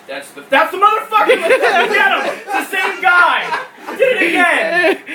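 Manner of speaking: high, loud hammed-up shout of recognition; excited mock shout. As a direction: yell like you spotted the guy who cut you off. Spotting the same driver again.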